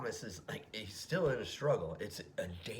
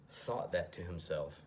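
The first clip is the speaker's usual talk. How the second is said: ordinary delivery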